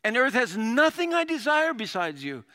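loud, high, fervent declaration; passionate. Acted. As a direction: read it out as an impassioned cry to God. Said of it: "Look at that passion".

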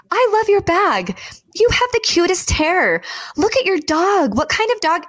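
high, bright, bubbly gushing; cheerful compliments. Retold or imitated. Imitating her friend gushing compliments at strangers. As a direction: gush warmly at strangers in the airport. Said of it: "you would say".

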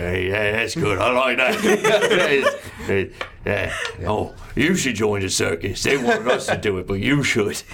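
odd little goblin voice; gurgling alien. Acted. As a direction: chatter like a pleased goblin. GM plays goblin character.

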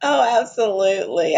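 ordinary delivery

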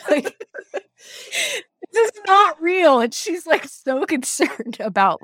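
high, loud words bursting through laughter; laughter lifting the voice, then easing; laughing. Real, not acted. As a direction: crack up while explaining. Cracking up retelling a funny scene.

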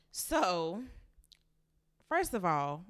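normal talking voice

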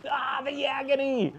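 high, hammed-up groan of agony; mock agony. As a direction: overplay the despair, mockingly. Melodramatic lament after prayer.

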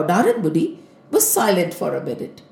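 plain reading voice

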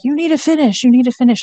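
a mother pressing her grieving daughter to keep going; mom insisting. Retold or imitated. Mother urging daughter to finish the semester, insistent mock push. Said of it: "my mom is like".

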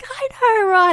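very high, thrilled squeal back; elated agreement. Retold or imitated. Mum calling back to the dad, elated agreement. Acts mum's elated answer.

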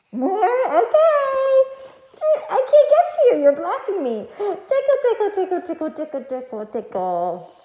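high loud tickle chant, dropping down; giggly tickle attack. Real, not acted. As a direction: squeal the tickles, then ease off. Tickling the child again.

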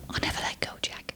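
hushed, breathy whisper; whispered Titanic quote. Retold or imitated. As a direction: breathe it like Rose to Jack. Quoting Titanic line.

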